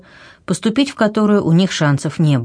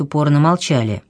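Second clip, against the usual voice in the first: ordinary delivery, nothing marked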